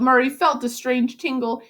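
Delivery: ordinary delivery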